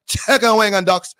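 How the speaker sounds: put-on Chinese accent with cut-down sounds; mock Chinese accent. Streamer cutting letters on a retry, high mock Chinese accent. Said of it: "I gotta cut the letters a little".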